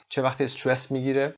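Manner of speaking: ordinary delivery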